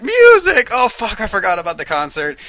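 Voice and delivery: high loud startled music; mock surprised gasp. Ariel remembers the concert, shrill surprised cry. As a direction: shriek it as you suddenly remember. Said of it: "Ariel's all like".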